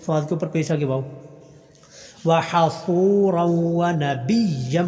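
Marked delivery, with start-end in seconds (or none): 2.2-4.8 slow drawn-out tajweed chant; slow tarteel recitation; Quran recitation. Shown as a demonstration. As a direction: recite each vowel long and measured. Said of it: "کوئی حرف لمبا نہیں".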